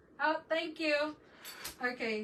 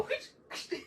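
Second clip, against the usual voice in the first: whoosh noise. Retold or imitated. Imitating quick hair brushing.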